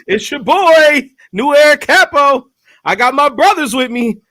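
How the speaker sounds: slow, high showman intro; hype host intro. Acted. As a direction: announce yourself like a radio host. Host introduces himself on air.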